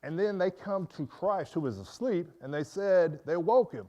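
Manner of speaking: ordinary talk, nothing marked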